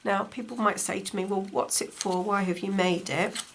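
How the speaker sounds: ordinary delivery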